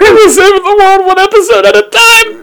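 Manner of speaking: high, loud hammed-up sign-off voice; silly high show-closer voice; mock announcer. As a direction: boom out the show's slogan like a cartoon hero.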